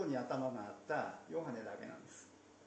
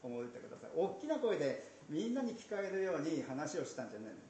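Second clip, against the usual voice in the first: ordinary delivery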